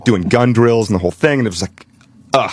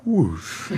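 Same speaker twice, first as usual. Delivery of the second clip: whoosh of a blade; 8 dB quieter than usual. Retold or imitated. Imitating the knife swing.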